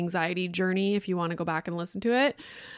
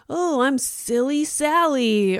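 put-on goofy kids' show intro; 6 semitones higher, 3 dB louder, slower than usual. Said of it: "It would be like".

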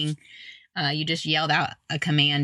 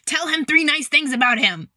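wrong tone; 8 semitones higher than usual. Retold or imitated. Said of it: "my tone's not right".

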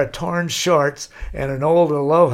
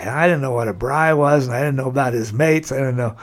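ordinary talk

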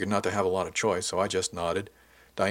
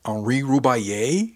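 slow, louder question rising at the end; astonished question; 3 semitones higher, 5 dB louder, slower than usual. A narrator's reading. Crewmate hearing the famous name, slow astonished question. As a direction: draw the name out in disbelief. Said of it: "she asked".

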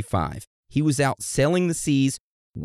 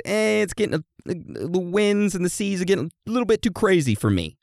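high, mock whiny excuse; hammed-up high-pitched hesitation; reluctant explorer; 7 semitones higher than usual. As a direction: back out in a silly raised voice. Voicing explorer backing out.

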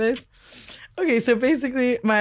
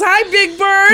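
teasing greeting; 10 semitones higher, 6 dB louder, slower than usual. Retold or imitated. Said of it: "he goes".

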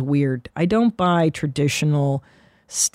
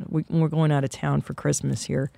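ordinary talk

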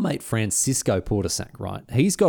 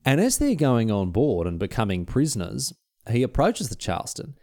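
ordinary delivery

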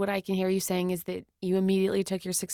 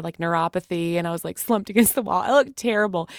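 ordinary talk, nothing marked

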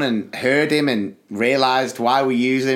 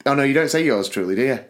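ordinary delivery

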